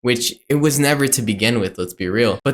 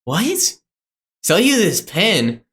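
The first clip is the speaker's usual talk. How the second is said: higher-pitched, incredulous imitation; mock bafflement; 6 semitones higher than usual. Seller asked to sell a pen, mock confusion. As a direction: act thrown by a silly request. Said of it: "he's genuinely confused".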